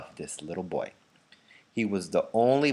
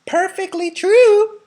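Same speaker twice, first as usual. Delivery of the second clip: put-on much higher, loud, slow old lady; grandma agreeing eagerly; loud high elderly cry; cried out; 10 dB louder, slower than usual. A narrator's reading. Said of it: "cried Grandma Josephine".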